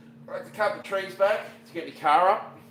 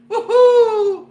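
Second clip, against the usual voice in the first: loud high whoop; 16 dB louder than usual. Real, not acted. Celebrating milestone.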